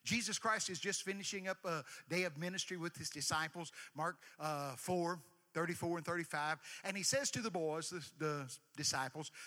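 normal talking voice

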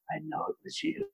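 whispered, high and hushed; quiet menace. Pretend. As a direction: breathe it out as a threatening whisper. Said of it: "very quietly say".

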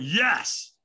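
retold second, firmer yes; stronger yes. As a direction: push the yes harder. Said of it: "I went".

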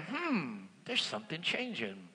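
soft, low aside to himself; hushed inner voice; quiet musing. Retold or imitated. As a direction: murmur the thought under your breath. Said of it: "I'm saying".

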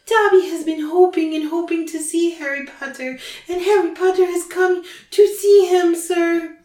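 high-pitched, eager house-elf voice; Dobby. A narrator's reading. House-elf delighted that Harry came to visit, squealing. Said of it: "squealed the voice".

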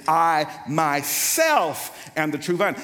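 slow "I myself" with attitude, "myself" punched; stress on MYSELF. Shown as a demonstration. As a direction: lean proudly on "myself". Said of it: "the Greek has the attitude".

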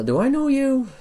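high gruff dwarf asking sharply; high dwarf clerk voice. Acted. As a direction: play a wary little dwarf clerk.

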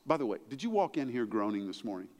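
normal talking voice

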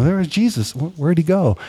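high-pitched, retold excitement; acting out a happy reunion; mildly excited, pitched well up; joyous. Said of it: "they have this re-, joyous".

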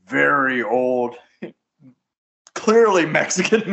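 ordinary talk, nothing marked

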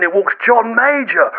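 normal talking voice

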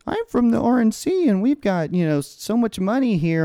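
high-pitched mocking spokesman voice; mock party official. RNC chair offering money at the memorial, mockingly high. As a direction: put on a silly, high voice for a self-important official.

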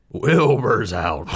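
slow announcing holler; calling out the news. Acted. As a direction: stretch it out slowly and loudly. Horses spread news of escape.

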